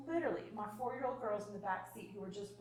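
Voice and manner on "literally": normal talking voice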